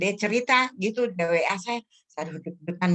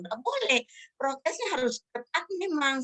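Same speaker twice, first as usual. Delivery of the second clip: high-pitched retold admonishment; insistent forbidding; 7 semitones higher than usual. Insisting he keep strict health protocol, high-pitched admonishing.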